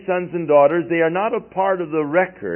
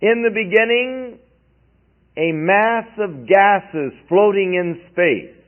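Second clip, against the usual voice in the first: mock version; 6 semitones higher than usual. Shown as a demonstration. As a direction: recite the rival creed with light scorn. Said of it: "Well, that's not the beginning".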